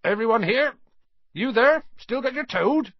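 high, loud head-count questions; calling out. Acted. Hagrid checks the group.

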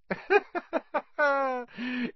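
high, cold mocking laugh. A narrator's reading. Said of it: "Riddle laughed his high laugh".

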